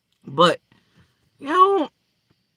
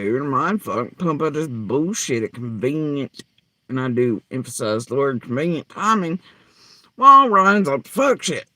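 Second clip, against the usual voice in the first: normal talking voice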